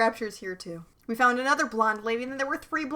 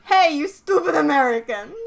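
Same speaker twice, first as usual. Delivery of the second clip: a loud, higher mock foreign accent sneering at Americans; Countess accent; 5 semitones higher, 8 dB louder than usual. Cohost voicing the Countess insulting the band, mock foreign accent. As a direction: jeer in a made-up European accent. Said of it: "her accent is when I'm not saying".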